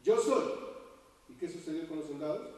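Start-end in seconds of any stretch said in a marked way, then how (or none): 0.0-0.7 loud, high declaration of identity; commanding voice. Acted. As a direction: proclaim it with full authority. Jesús answers the soldiers. ("Yo soy" proclamado con fuerza)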